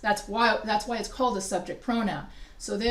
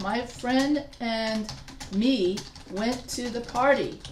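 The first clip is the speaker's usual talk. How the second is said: slow, careful wrong example; slower than usual. Shown as a demonstration. Teacher to class, slow and careful wrong example. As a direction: spell out the error slowly. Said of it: "that's not correct".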